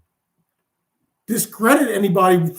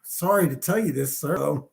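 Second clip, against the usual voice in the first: lower, softer, reluctant report; apologetic underling; 7 semitones lower, 6 dB quieter than usual. Acted. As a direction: play a scout sheepishly breaking bad news. Spy giving commander bad news.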